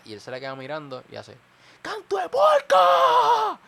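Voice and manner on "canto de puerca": very high, drawn-out full-force shout; very hard yell. Retold or imitated. Young man yells at the littering woman, very hard drawn-out shout. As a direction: imitate a bystander bellowing an insult. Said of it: "un grito tan y tan duro".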